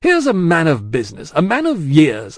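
loud, high, indignant tirade; blustering big man in a temper; angry. A narrator's reading. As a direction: build an outraged list, phrase by phrase. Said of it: "glanced at the angry Stryver".

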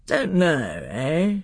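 put-on gruff uncle echoing the child; a king's disapproving echo; Miraz. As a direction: throw the boy's words back, grown-up and challenging.

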